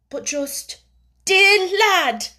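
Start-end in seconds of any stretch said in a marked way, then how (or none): 1.3-2.3 higher, louder moved mother; a mother choked with feeling; tremulous. A narrator's reading. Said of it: "quite suddenly".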